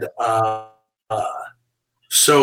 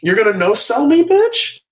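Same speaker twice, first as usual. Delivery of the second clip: mock outrage; 9 semitones higher than usual. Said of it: "that look of".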